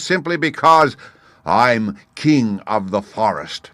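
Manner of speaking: loud, high, slow proud lion boast; dignified lion. A narrator's reading. Lion boasting to the gnat, loud and dignified. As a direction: puff up and declare it grandly. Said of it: "he repeated in dignified tones".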